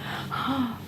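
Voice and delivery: high, quiet gasp of emotion; soft gasp. Real, not acted. Moved by homecoming videos, soft gasp.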